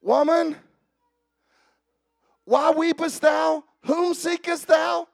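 loud, high, slow calling voice; voicing Jesus. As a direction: call out to her as Jesus. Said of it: "Okay, here we go".

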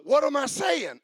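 ordinary talk

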